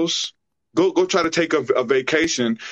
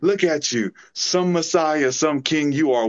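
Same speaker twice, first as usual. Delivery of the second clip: high sneering taunt, much higher than his own voice; mocking thief; 7 semitones higher than usual. Dying thief on the cross taunting Jesus, high mocking sneer. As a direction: jeer at him like a dying thief scoffing at a fake king. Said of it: "mocked him with his dying breath".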